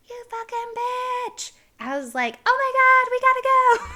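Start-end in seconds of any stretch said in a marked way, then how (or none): 0.1-1.5 very high, drawn-out mock cry; put-on squealed curse, slow and high; imitated angry man. Guy with pee on his shoes cursing her, mock outrage. 2.5-3.9 high, loud mock-panicked yell; acted panic. Woman fleeing after kicking the guy, panicked yell.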